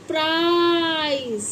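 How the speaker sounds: प्राइज stretched slowly, long ai; drawn-out demo word. Shown as a demonstration. Teacher to pupils, slow drawn-out demo. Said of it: "क्या पढ़ा जाएगा".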